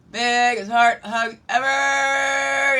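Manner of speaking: high, loud, drawn-out cheer; big slow celebratory holler; exuberant shout. Real, not acted. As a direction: shout it out, stretching the last word long.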